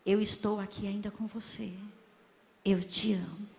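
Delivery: God's voice, low and solemn; voz de Deus. Retold or imitated. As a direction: drop the pitch to speak as God. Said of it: "o Senhor falou assim". (voz de Deus, grave e solene)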